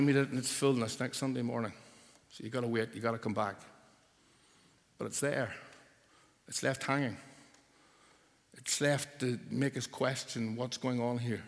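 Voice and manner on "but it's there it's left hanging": ordinary talk, nothing marked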